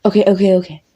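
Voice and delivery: loud, hurried attempt to placate; alarmed surrender. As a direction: blurt it out to stop them shooting. Threatened with being shot.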